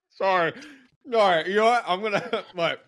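laughing through a mouthful; mouth full. Real, not acted. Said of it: "I'm chewing on, this is bad".

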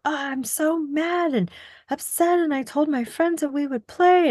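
put-on high, slow whiny child voice; upset child voice. Mother imitating her upset son, in a high whiny child voice. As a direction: mimic her son's upset complaint.

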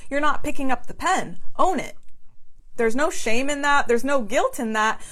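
usual speaking voice

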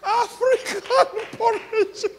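high, slow words broken by laughter; giggling repeat of porridge; laughing. Real, not acted. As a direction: laugh helplessly through it. Laughing retelling of gift.

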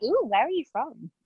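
nosy, high swooping "ooh"; intrigued, pitch gliding up on "ooh"; curious accent spotter. Acted. Host mimics herself spotting someone's accent, curious. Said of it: "anytime I pick up an accent".

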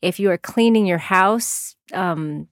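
usual speaking voice

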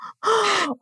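high sharp gasp; shocked gasp. Real, not acted. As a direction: gasp in disbelief. Listener shocked by story.

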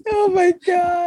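high, helpless laughter; laughing at the flying-dough story. Real, not acted. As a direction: crack up in disbelief. Laughing at baking disaster story.

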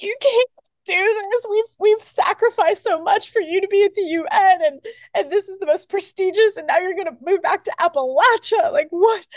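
high, pleading imitation of a crying mother; imitating sobbing mom. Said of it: "my mom started crying, like, sobbing".

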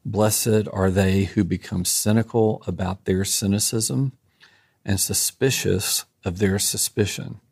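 slow, measured benediction reading; unhurried reading so listeners catch each line. Real, not acted. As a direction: read it slowly, pausing between each blessing. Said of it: "I'll try to read this slowly".

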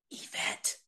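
soft whisper of "Yvette"; calling the soldier's name in a whisper in the queue. Shown as a demonstration. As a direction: breathe "Yvette" unvoiced, very soft. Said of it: "like that".

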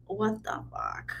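slow, soft, hushed mutter of disbelief; a hushed aside of shock at the task; stunned disbelief. Retold or imitated. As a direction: let it drop out quietly, stunned. Disbelief at having to help.

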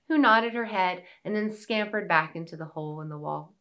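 plain reading voice

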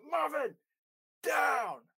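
high, loud command to drop. Retold or imitated. Professor ordering the dog down from afar, loud high command. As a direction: bark the name then the command across the road. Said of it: "And he goes".

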